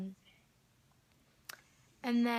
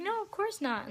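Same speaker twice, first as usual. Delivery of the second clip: retold friendly reassurance; 11 semitones higher than usual.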